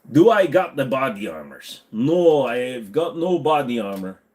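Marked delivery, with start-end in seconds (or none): none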